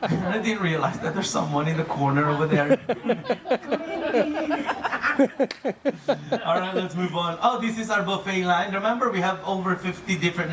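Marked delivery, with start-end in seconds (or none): 2.9-6.4 high embarrassed laugh. Real, not acted. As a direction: laugh it off, caught out. Laughs at someone in corner.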